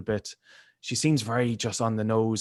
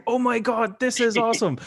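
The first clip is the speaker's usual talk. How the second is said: high-pitched, acted-out joyful amazement; childlike glee; 11 semitones higher, 3 dB louder than usual. Fan acting out Superman's first flight, high childlike glee. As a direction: squeal it like a thrilled kid. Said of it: "this kind of joyful, childlike glee".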